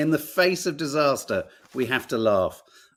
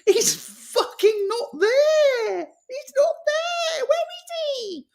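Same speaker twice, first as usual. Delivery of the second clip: much higher, slow wailing panic; mock a politician wailing in panic; hammed-up panicked wail; panicking politician; slower than usual. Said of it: "politicians going".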